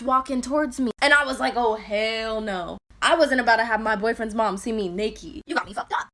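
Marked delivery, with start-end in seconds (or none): 1.6-2.8 low, slow, drawn-out refusal; slow, low "oh hell no" at the boyfriend's mom. Retold or imitated. Seeing boyfriend's mom approach. 5.5-6.1 breathy secret aside to the viewers; whispered "you got me fucked up". Real, not acted. Aside about being seen naked.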